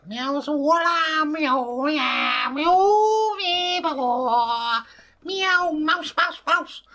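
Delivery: high, loud meowed incantation; cat language. Acted. Dave the cat casts his runestones, chanting in cat language. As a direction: yowl a spell like a cat. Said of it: "Cat language".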